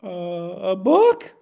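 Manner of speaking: put-on sweet, innocent little puppet kid; Kermie-style sweet kid; Kermit-like voice. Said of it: "a little Kermie voice".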